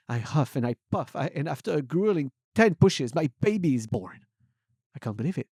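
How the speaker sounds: usual speaking voice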